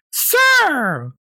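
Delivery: high-pitched imitation of an outraged woman; mock yelling. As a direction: call out very high, mimicking her yell. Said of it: "yelling at me".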